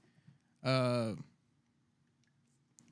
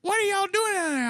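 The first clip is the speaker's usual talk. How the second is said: high, louder mock guard; shrill-free high mock guard; 4 dB louder than usual. Guard bursting in, high mock voice.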